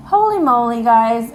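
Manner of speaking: loud, astonished cry; excited exclamation. Real, not acted. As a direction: burst out in amazement. Shocked reaction to drawn card.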